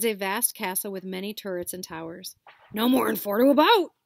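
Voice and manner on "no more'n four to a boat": very loud, higher call. Acted. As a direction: order across the shore. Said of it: "Hagrid called".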